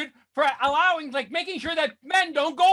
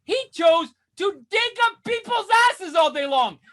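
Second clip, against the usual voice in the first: normal talking voice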